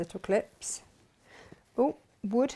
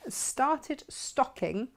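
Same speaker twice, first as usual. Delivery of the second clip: slow careful; 4 semitones higher, much slower than usual. Shown as a demonstration. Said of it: "Have I said that right?".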